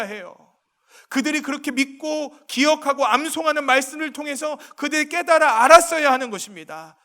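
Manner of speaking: loud, high, impassioned sermon delivery; rising fervent pulpit voice; full-force preaching. Acted. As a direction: preach it out to the whole hall.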